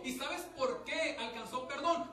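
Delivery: full-force pulpit preaching; fiery preaching. Acted. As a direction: preach it out to the congregation with rising force.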